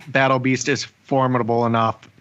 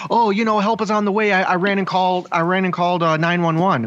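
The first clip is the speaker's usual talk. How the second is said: higher, breezy fake-casual lie; mock Clark Kent excuse; 6 semitones higher than usual. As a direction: play innocent like Clark Kent. Imitating Mark's lie.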